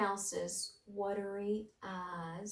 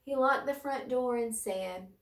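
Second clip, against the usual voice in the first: usual speaking voice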